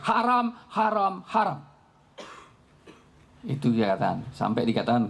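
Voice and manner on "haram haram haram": stern condemnation. Retold or imitated. Umar condemns the purchase.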